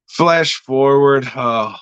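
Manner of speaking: ordinary talk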